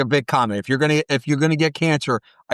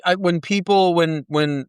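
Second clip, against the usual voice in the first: usual speaking voice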